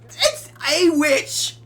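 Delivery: very slow, high and loud; mock accusing cry. Player pointing at the teenager, a slow high cry. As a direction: drag out the accusation like a mob.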